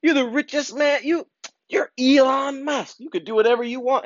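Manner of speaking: high hammed-up Joe Rogan insisting; coaxing Joe Rogan. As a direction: needle him playfully, up high. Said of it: "He said all, all throughout".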